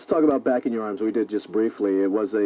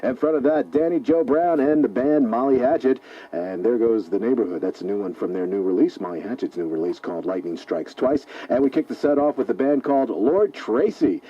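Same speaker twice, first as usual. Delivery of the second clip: upbeat rock radio host voice, pitched up and punchy; radio DJ announcer; 4 semitones higher, 3 dB louder than usual. Acted. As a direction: sell the show to the listeners. Radio DJ hosting the show.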